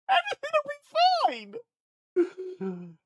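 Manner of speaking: very high then dropping to almost nothing; squeaky reassurance. Pretend. Streamer mock-soothing himself, squeaking high then fading out. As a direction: squeak it out, then let it die.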